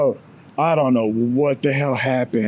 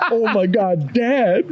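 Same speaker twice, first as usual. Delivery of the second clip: higher-pitched daughter imitation, rising on the last word; mortified daughter; 6 semitones higher, slower than usual. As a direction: imitate an embarrassed kid, half laughing.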